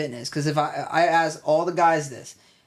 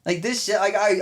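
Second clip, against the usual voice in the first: ordinary talk, nothing marked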